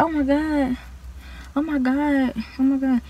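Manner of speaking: the nurse quietly horrified by the smell; hushed repeated dismay. Retold or imitated.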